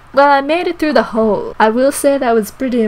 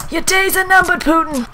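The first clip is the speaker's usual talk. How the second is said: high, hammed-up threat; high-pitched battle cry; mock threat; 7 semitones higher than usual.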